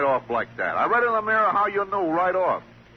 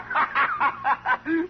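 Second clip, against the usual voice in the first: high cackling laugh at the punchline; 8 semitones higher than usual. Acted. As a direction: laugh hard at your own joke. Comedian laughing at own joke.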